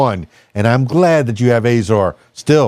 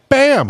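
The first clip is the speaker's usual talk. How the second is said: mock blam; 11 semitones higher than usual. Joking punchline sound.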